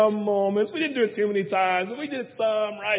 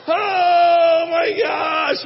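slow, high, loud stretched-out cry; shocked man reads divorce in his Bible, wailing high and loud; horrified gasp; 9 semitones higher, 9 dB louder, much slower than usual. Retold or imitated. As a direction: drag out a shocked wail. Reaction to reading "Divorce.

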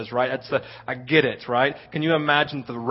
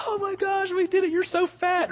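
put-on high, soft squealing girls' voices; excited little girls; 4 dB quieter than usual. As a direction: imitate the giddy sixth graders. Voicing excited sixth grade girls.

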